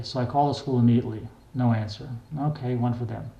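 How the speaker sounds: ordinary delivery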